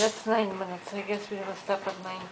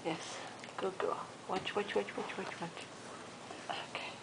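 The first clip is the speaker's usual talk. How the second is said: whispered praise; 11 dB quieter than usual. Real, not acted. Soothing the dog.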